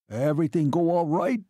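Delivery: little man's anxious query. A narrator's reading. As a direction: ask anxiously, lifting the pitch. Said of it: "the little man said anxiously".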